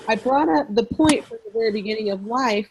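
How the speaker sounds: ordinary delivery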